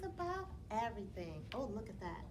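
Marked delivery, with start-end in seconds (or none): none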